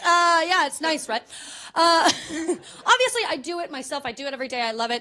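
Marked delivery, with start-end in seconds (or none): none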